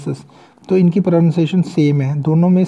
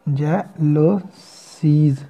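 very slow, stretched pronunciation; drawn-out demo word; much slower than usual. Shown as a demonstration. Teacher sounding out jealousies for students, slowly. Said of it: "साथ में circle एक add कर देंगे".

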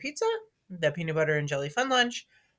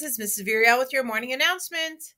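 announcer; 6 semitones higher, 4 dB louder than usual. Acted. School morning announcement.

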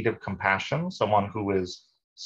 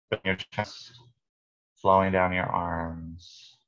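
calm meditation guide, long pauses between phrases; guided meditation. Real, not acted. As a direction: lead listeners unhurriedly through breath and body.

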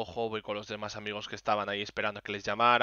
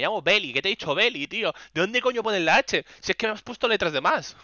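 exasperated rant; 7 semitones higher, 4 dB louder than usual. Real, not acted.

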